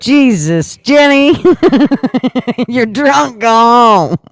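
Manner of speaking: loud high laughing outburst; laughing exclamation. Real, not acted. Laughing exclamation at own mistake.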